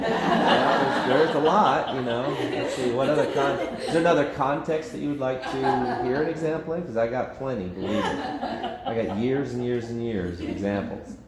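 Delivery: amused, chuckling as he talks; laughing. Real, not acted. Laughs while answering request.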